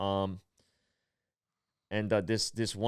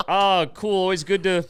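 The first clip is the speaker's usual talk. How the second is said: much higher and louder, forced cheer; fake enthusiasm; 8 semitones higher, 6 dB louder than usual. Comic faking school spirit to the woman, hammed-up cheer. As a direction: bluff like a proud alum.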